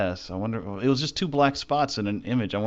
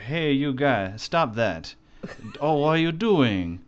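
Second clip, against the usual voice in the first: put-on choppy Japanese-accented English, high and loud; japanese learner accent; 4 semitones higher, 3 dB louder, much slower than usual. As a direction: imitate a Japanese speaker's English. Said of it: "some great Japanese English".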